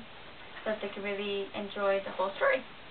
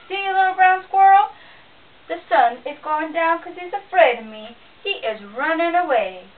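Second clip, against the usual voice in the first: loud, higher boastful bear voice; gloating bear; 5 semitones higher, 9 dB louder than usual. A narrator's reading. Bear gloating at the squirrel, loud. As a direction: crow over the little squirrel.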